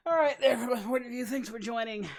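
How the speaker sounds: high, loud excited hello; hyped greeting. Real, not acted. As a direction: greet everyone like a hype host. Streamer hypes greeting to chat.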